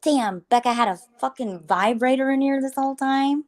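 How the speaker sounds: acted-out amazed exclamation; astonished. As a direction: imitate a staffer stumbling on a scandalous find. Retelling hospital cleaners' astonished find.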